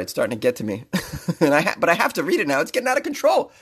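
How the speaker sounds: normal talking voice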